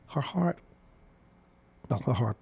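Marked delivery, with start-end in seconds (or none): none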